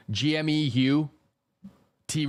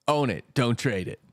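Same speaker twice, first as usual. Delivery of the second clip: joking voice. Acted. Streamer jokes to chat about silver, put-on voice. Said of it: "No, but seriously".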